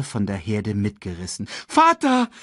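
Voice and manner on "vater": high, louder desperate call. A narrator's reading. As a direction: call after falling father. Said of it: "rief Simba". (Simba ruft verzweifelt laut)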